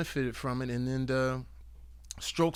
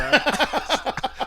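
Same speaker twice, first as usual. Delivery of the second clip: big laugh; 13 semitones higher, 7 dB louder than usual. Real, not acted. Laughs at his interceptions joke.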